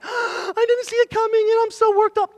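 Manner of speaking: high, loud, hammed-up wail after a gasp; mock panicked overreaction. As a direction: act all shocked and worked up. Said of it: "Don't be, like, acting all like".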